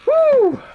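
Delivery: high, loud "woo"; big high-pitched woo; loud whoop. Real, not acted. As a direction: let out a sudden high whoop. Exclaims after nearly choking.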